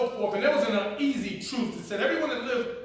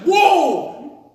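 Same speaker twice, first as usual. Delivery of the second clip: startled whoa; 12 semitones higher, 11 dB louder than usual. Retold or imitated. Startled by family finding him.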